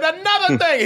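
high-pitched, insistent comeback; defiant retort. Retold or imitated. Son pressing on against his father, defiant. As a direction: push back, pitch jumping up.

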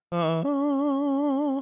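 high ghostly falsetto hum. Shown as a demonstration. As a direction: slip into the spooky falsetto. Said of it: "If you get that ghostly thing".

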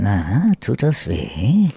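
slow, low sadistic villain voice; dark lord. Shown as a demonstration. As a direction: taunt the victim gleefully. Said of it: "wie klingt der Psycho, wenn Santiago den Psycho macht".

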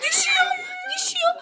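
high panicked laughing yelp; alarmed laughing cry. Real, not acted. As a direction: cry out as it tips. Cake about to fall.

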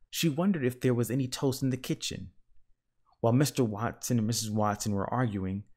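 ordinary delivery

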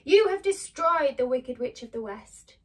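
loud wizard's proclamation; booming voice. Acted. Hidden wizard addresses Dorothy after long wait, booming. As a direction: boom it out with authority. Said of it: "the voice boomed out".

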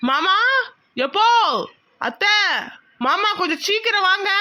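high, insistent summons; urgent calling out. Acted. Nephew summoning his uncle to hurry, urgent calling out. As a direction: call them out of the house. Calls uncle to come quickly.

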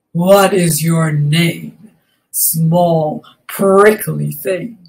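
low, slow, menacing rhino voice; unkind rhinoceros. A narrator's reading. Rhinoceros questioning small Fluffy, unkindly. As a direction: draw it out deep and mean. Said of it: "asked the rhinoceros unkindly".

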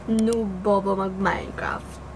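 slow hushed whisper; whispered read. Retold or imitated. Vlogger reading a hate comment aloud in a slow whisper. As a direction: breathe the insult out slowly, no voice.

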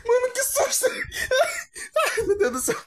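high shriek then nervous laughter; startled scream. Real, not acted. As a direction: jump scare, scream and laugh. Jump scare, screams then laughs.

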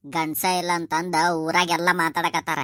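ordinary talk, nothing marked

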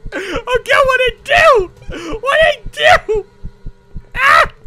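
high wailing cries; panicked crying. Real, not acted. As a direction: wail in terror.

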